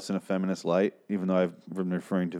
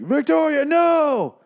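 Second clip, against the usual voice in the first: much higher, louder anguished scream of her name; anguished cry; 6 dB louder, much slower than usual. Acted. Husband screaming as his wife vanishes, high anguished cry.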